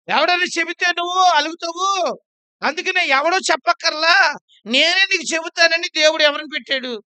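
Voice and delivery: high, loud rebuke to the congregation; preaching shout. Real, not acted. Preacher shouting a challenge at the congregation about anger. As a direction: hammer the question home at full voice.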